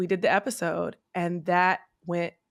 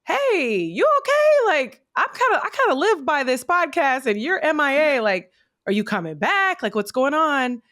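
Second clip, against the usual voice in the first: high, excited fan voice, much louder; imitating fans; 7 semitones higher, 5 dB louder than usual. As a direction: play worried fans pleading, pitched way up. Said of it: "ten messages of different women like".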